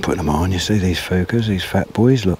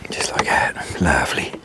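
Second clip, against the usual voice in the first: whisper; 4 semitones lower, 4 dB quieter than usual. Real, not acted. Whispering while fishing quietly.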